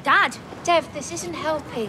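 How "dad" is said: sharp, loud call to stop him; protesting shout. Acted. Daughter cutting into her dad's row, protesting shout. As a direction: cry out in protest.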